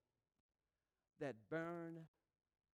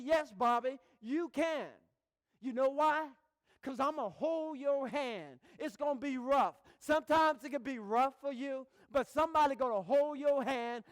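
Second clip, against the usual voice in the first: louder, higher, rousing encouragement; preaching nurse; 8 semitones higher, 9 dB louder than usual. Retold or imitated. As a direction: lift them up like a preacher.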